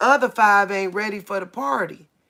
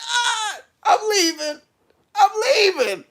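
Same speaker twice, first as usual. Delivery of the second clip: high, loud, fleeing cry; panicked; 14 semitones higher, 4 dB louder than usual. Real, not acted.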